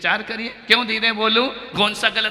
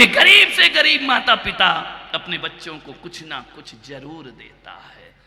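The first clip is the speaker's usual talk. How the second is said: normal talking voice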